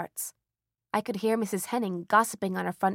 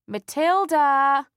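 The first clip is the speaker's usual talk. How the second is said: long, loud, drawn-out call, higher; calling up the stairs; 7 semitones higher, 10 dB louder, much slower than usual. Acted. Mother calling Matilda from downstairs, slow loud call. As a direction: call her daughter from below. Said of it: "Mother's voice called up the stairs".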